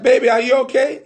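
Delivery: high, loud and quick call; woman calling out. Acted. Woman calling to the man from the living room, high and loud. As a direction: call sweetly through the house.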